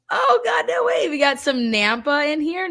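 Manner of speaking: high thrilled no way; delighted surprise. Real, not acted. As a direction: gasp it out in disbelief at a hometown viewer. Viewer from hometown Nampa.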